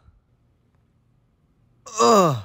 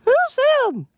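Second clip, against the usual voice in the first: put-on high girly voice. Amy voice.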